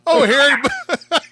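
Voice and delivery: laughing. Real, not acted. Laughing about hairy butt.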